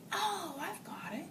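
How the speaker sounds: slow hushed aha; whispered realization. Retold or imitated. Officer suddenly figuring it out, in a slow whisper.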